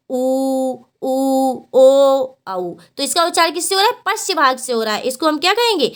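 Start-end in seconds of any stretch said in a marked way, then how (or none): 0.1-2.4 ऊ, ऊ, ओ each held long and level; back vowels. Shown as a demonstration. Teacher demonstrating back-of-tongue vowels, ऊ and ओ held out. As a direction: sustain each vowel ऊ, ऊ, ओ separately. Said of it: "जीभ के पीछे भाग से".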